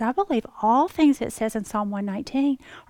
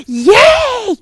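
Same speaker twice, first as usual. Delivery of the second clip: much higher, louder squeal of joy; gleeful cheer; 12 semitones higher, 13 dB louder than usual. Retold or imitated. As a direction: cheer like a kid let out of school. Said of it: "And I went".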